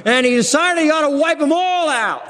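preaching shout. Real, not acted. Preacher building on Moses' fury.

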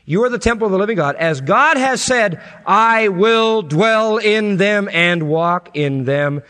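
loud, high, impassioned preaching; soaring revival preacher cry; preaching at full force. Acted. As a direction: build it up like a pulpit climax.

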